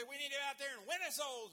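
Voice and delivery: loud, high preaching cry; preacher whipping up the congregation, loud and high; fired-up preacher. Retold or imitated. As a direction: shout it like a preacher rallying the pews. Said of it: "he'd get fired up and say".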